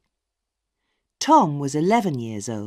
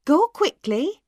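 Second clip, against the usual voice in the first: high, fast and louder mother; hurrying mother; 8 semitones higher, 5 dB louder than usual. A narrator's reading. Mother hurrying Maggie before dinner, fast and high. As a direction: rush her off to the table.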